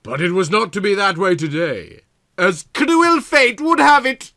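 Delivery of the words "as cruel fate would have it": melodramatic doom. Acted. Melodramatic narration of bad fiction.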